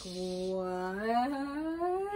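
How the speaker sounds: long, drawn-out what sliding upward; amazed. Retold or imitated. Bree sees the sparkling hand, drawn-out amazement. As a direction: stretch it out in mock astonishment.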